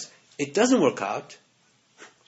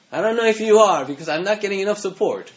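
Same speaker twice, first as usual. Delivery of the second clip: louder, higher complaining prayer; mock complaint; 5 semitones higher, 5 dB louder than usual. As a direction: whine at God for more support. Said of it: "And you ask".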